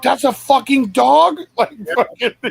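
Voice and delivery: high, loud, slow astonished question; stunned at a TikTok of giant dogs; amazed disbelief. Retold or imitated. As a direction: drag it out in disbelief. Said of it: "I was like".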